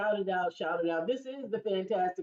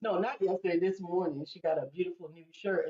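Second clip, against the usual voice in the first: ordinary talk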